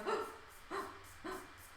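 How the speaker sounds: gasp. Retold or imitated. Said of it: "And then I was like".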